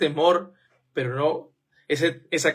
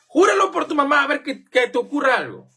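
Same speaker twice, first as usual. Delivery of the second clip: higher, louder, pushy mock demand; 9 semitones higher, 4 dB louder than usual. Preacher mimicking a friend demanding an oath, pushy and high. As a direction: egg them on to swear.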